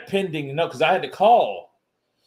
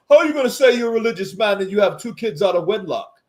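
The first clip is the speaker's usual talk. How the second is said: fast, louder mocking jab at a boxer; Lennox Lewis taunt; 6 semitones higher, 4 dB louder, faster than usual. Retelling a boxer's insult, quick and louder. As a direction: rattle off a scornful taunt. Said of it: "That's what he told Holyfield".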